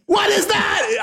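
very high, frantic mock screaming; really loud yelling. Hero questioning the innkeeper, hammed-up frantic screaming. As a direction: shriek each question like a hopelessly clueless hero. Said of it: "wow, that was really loud".

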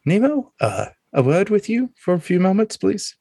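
politely request a word, pitched high; Severus. Acted. Carnival man asking his friend for a private word, in character. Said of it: "Severus is like".

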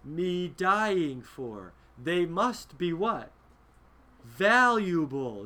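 slow, deliberate lines to the orcs; lightly voiced Boromir, slowed right down; Boromir's defiant message. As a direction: drag it out as if each word costs his life. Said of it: "He's telling the orcs".

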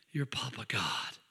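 whispered tender aside. Acted. Preacher whispering to the congregation, tender hush. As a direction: breathe it like a secret.

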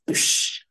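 unvoiced "psh" smash sound effect; imitated crash noise. Friend telling how his bong cracked, mimicked crash. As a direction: imitate glass bursting with a sharp "psh".

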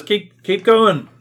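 high, loud, drawn-out priest's call; urging priest. Acted. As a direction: urge them on loudly and slowly. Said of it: "the priest says".

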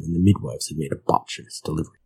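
usual speaking voice

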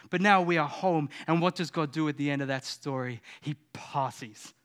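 usual speaking voice

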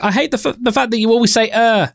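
high mock complaining listener voice; shouty listener. Podcaster mocking a shouty listener, high whiny mock voice. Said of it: "that one shouty person that's like".

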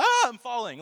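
mock panic. Imitating a panicked faller.

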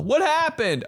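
high-pitched startled question; shocked questioner. Acted. Onlooker asking about the injuries, high mock alarm.

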